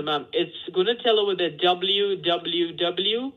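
ordinary talk, nothing marked

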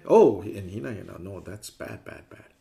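a prissy scolding that fades; mock prim scolding. Teacher parodying the strict Hinayana view, with a fading tut-tut. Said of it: "But it doesn't mean".

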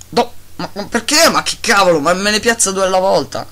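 fed up with the game; exasperated. Real, not acted. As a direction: gamer ranting at the laggy game. Game places two blocks.

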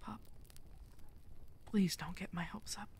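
soft whispered wonder; hushed disbelief. Acted. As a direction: barely dare to hope.

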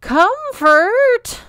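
very high, loud drawn-out plea; imploring cry. A narrator's reading. Peach begging his cousin to stop, imploring cry. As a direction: beg her to stop, stretching the name. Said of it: "Peach implored".

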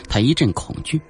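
usual speaking voice

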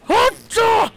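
very high, loud alarm cry; frantic high yell; panicked shout. Real, not acted. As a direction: scream the warning. Attacked by a creature.

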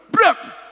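shouted imitation of a slam on the pulpit; high loud slam sound; pulpit bang.

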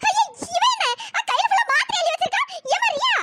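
high, crying alarm at the pills; frantic wail of fear; panicked cry. Acted. Grandmother finds girl with pills.

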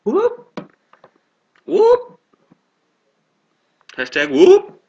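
high, loud whoops; excited whoops. Real, not acted. Co-host cheers along with a high, loud whoop.

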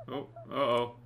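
ordinary talk

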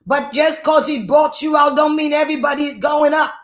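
driving, high-pitched sermon delivery; full-force preaching. Real, not acted. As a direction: preach it hard in relentless, repeated exhortations.

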